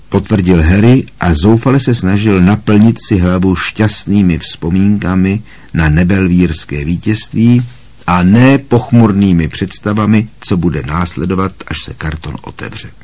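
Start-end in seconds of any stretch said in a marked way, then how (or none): none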